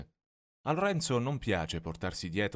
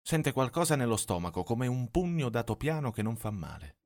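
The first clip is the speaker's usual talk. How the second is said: plain reading voice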